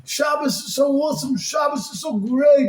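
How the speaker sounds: high, rapturous calling out; fervent, drawn-out exaltation; ecstatic cry. Acted. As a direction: build to a long held shout on the word. Ecstatic acted cry about Shabbos.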